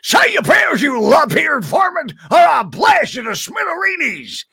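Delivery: high, loud cartoon cowboy shout; Yosemite Sam voice. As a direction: holler it like a hot-headed gunslinger. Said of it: "And Sylvester, and he was".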